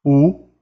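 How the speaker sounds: U with rounded pursed lips. Shown as a demonstration. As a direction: pucker the lips for the French u. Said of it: "A gente faz a boca do U".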